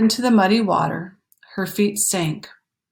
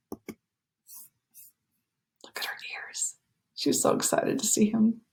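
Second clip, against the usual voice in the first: whispered aside; 3 semitones higher, 7 dB quieter than usual. Real, not acted. Aside to child.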